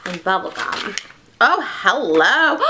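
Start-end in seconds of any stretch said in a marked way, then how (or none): none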